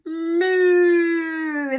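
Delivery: loud, long mooing like a cow. Shown as a demonstration. Teacher showing kids a cow's sound, loud moo. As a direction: moo big for the children. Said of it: "what sound a cow makes".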